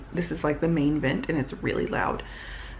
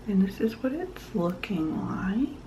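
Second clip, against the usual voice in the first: soft whisper; hushed aside; 2 semitones higher, 6 dB quieter than usual. Real, not acted. Quiet aside while showing knitting.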